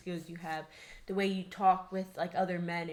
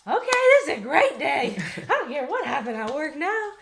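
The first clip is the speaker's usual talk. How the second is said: higher, louder and laughing, overjoyed; excited delight; 7 semitones higher, 9 dB louder than usual. Retold or imitated. Wife telling husband how his news lifts her, excited and laughing. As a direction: burst out with giddy relief.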